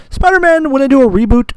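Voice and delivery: sudden high fan squeal over Spider-Man; excited squeal. Real, not acted. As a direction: let Spider-Man fly out in a high gush.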